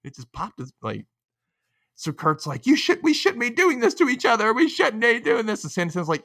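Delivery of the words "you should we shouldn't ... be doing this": high-pitched imitation of an upset protest; Kurt. Podcaster imitates Kurt objecting to the dodgeball fight, high mock pleading.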